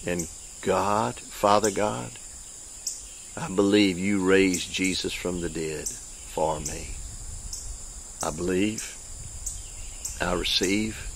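soft, slow prayer for the congregation to repeat; gentle repeat-after-me prayer; led prayer. Acted. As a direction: pray it quietly and sincerely. Leads prayer.

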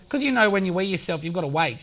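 usual speaking voice

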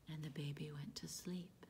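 sleepy hushed whisper. Acted. Storyteller telling children the baby fell asleep, whispered.

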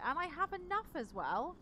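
normal talking voice

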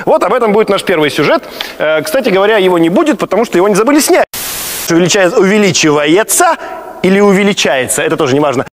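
loud showman host voice; TV host presenter. Acted. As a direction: announce like a TV presenter.

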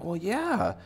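high put-on voice; sheepish admission acted. Townsfolk admitting they hid the carrot, high reply. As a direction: answer the bunny in a high voice. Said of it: "And they're like".

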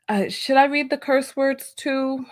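ordinary talk, nothing marked

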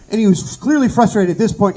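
ordinary delivery

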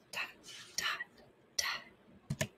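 whispered dot, dot, dot like soft knocks; whispered knocking. A narrator's reading. Narrator whispering the soft knock at the door. Knocking sound read as story.

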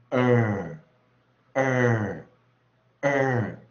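loud, sustained r-coloured 儿; American long vowel demo. Shown as a demonstration. Teacher showing how American long vowels read, loud held 儿. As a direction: hold each 儿 long and let it fall, with pauses between. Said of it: "它就念".

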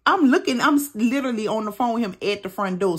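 ordinary talk

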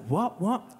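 high, loud incredulous outburst; astonished. Retold or imitated. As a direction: act out being completely taken aback. Acting own astonishment at mother.

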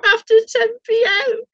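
high-pitched squeal through laughter; helpless high laughing punchline. Real, not acted. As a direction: barely get it out for laughing, voice shooting up. Laughing punchline about Boris drinking.

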